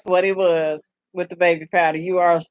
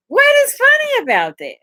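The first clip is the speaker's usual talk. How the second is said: exasperated; 14 semitones higher, 4 dB louder than usual. Real, not acted. Exasperated rant at viewers.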